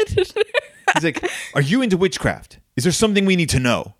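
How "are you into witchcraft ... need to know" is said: high-pitched, urgent parental interrogation; hammed-up dad confrontation, pitched high; accusing dad. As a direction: voice an alarmed dad demanding answers. Dad confronts son in retold story.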